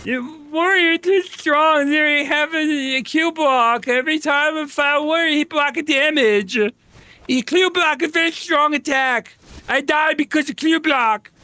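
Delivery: high, slow broken-English whiner voice; mocking BDO players. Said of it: "I just roleplay it as half the B D O community".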